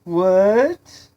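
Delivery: loud, high rising shout; irritated call back. Acted. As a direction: fling the question back down the hall. Friend turns back, calls out.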